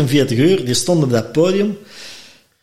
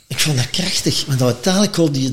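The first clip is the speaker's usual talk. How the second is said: ordinary talk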